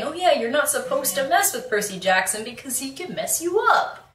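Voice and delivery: singsong reminder climbing at the end; lightly put-on voice, pitch leaping on the last word; mock reminder. Voicing people who forget Percy is dangerous, mock reminder.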